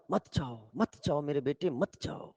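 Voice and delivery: soft, low family plea; pleading parents. Acted. Family begs their son not to leave, soft pleading. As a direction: beg softly, letting it die away. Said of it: "उसके घर वालों ने कहा".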